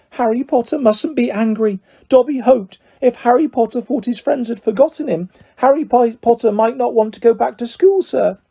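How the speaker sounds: very high elf voice, apologetic; Dobby, pleading house-elf. Acted. Elf begs Harry not to be angry, high and pleading.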